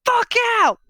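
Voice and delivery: high, loud furious shout; scream. Acted. Game master voices Alba rejecting the third hot chocolate, screaming. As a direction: scream it at full pitch to drive them away. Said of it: "where she screams".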